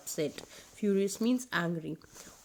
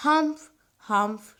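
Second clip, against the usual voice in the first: rude humph; 2 semitones higher, 7 dB louder than usual. A narrator's reading. Camel rudely grunts humph to everyone. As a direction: snort it off rudely. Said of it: "replying in a very rude manner".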